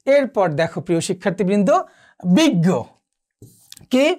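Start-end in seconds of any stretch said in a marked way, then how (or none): 2.2-2.7 বিজ্ঞ said as big-go, with a doubled g; doubled g sound. Shown as a demonstration. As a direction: hold the g in বিজ্ঞ before releasing it. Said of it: "এর মতো হবে।".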